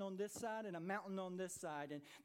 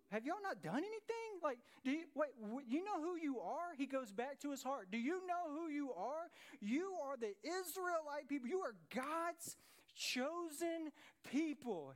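indignant David; 6 semitones higher than usual. Retold or imitated. David indignant at the frightened army.

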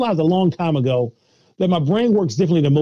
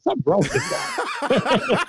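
mock bystander; 4 dB quieter, slower than usual. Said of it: "they would think".

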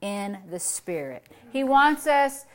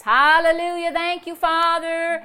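loud, exultant praise to God; rejoicing praise; 4 semitones higher, 6 dB louder than usual. Shown as a demonstration. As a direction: rejoice out loud with ringing hallelujahs. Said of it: "I can praise God and rejoice".

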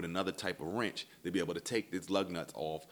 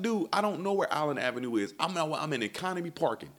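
high, louder insistence; exasperated protest; 9 semitones higher, 4 dB louder than usual. Retold or imitated. Caller repeats his location to tow guy, exasperated. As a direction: argue back, at the end of your patience. Exasperated reply in retold quarrel.

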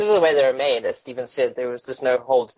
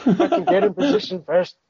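put-on higher, slow old-timer drawl; put-on old man voice, higher and slower; old man soldier voice; 6 semitones higher, 4 dB louder than usual. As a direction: play the kindly old sniper. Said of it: "It's like".

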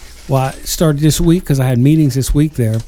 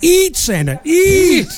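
coaxing; 14 semitones higher, slower than usual. Pretend. Coaxing Santa to eat.

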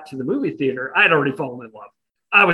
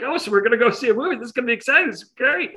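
higher, fast, bubbly imitation of an excited date; chipper enthusiasm; 6 semitones higher, faster than usual. Retelling his date's excitement, an imitated, higher, fast chipper voice. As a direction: gush like a girl thrilled about the movie. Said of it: "she is kinda all enthusiastic".

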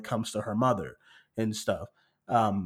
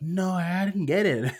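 a touch higher, innocent denial; lightly voiced Misty lying; 6 semitones higher than usual. Misty lying to Pearl about the part, mild innocent voice. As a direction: play it light and falsely innocent.

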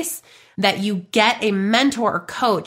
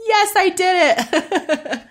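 high, slow, hammed-up little victory cheer; mock triumph; 10 semitones higher, much slower than usual. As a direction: celebrate three tears like a big win, half joking. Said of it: "I'm like".

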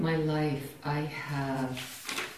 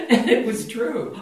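laughing; 7 semitones higher, 7 dB louder than usual. Real, not acted. Laughing at her own story.

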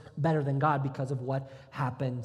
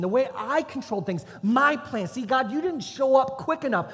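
loud, high, insistent pleading; defiant protest; 8 semitones higher, 8 dB louder than usual. Acted. Abraham rejects God's promise for his own plan, defiant protest. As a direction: push back hard, demanding your own way. Abraham refuses and bargains with God.

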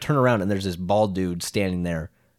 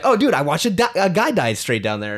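blurted shock; 8 semitones higher, 4 dB louder than usual. Retold or imitated. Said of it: "and I was like".

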